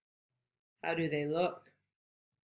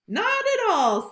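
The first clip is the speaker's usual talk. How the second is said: high, louder bright rabbit voice; high cheerful Rabbit; 13 semitones higher, 6 dB louder than usual. Acted. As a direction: brush off the worry as Rabbit.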